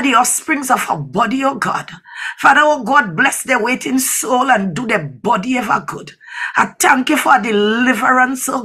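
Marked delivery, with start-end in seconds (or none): none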